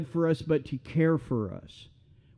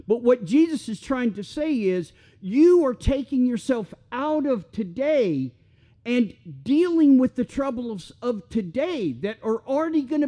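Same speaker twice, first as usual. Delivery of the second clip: higher, fast, urgent preacher voice; fervent preaching; 8 semitones higher than usual. Acted. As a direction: drive the point home to the congregation. Preaching builds, much higher pitch.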